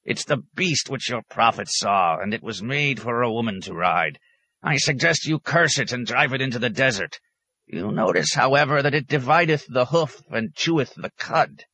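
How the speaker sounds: high, pedantic scriptural retort; crotchety hermit in a high voice; cross old hermit. A narrator's reading. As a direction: argue it like a crabby prophet.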